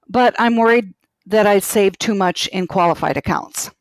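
ordinary delivery

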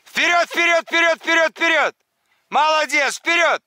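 high loud repeated calls; shouting to a dog. Real, not acted. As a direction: urge the dog on at full voice. Urging dog into water.

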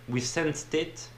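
slow English tagline with a heavy French accent. Shown as a demonstration. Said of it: "je te le dis en anglais". (anglais lent avec fort accent français)

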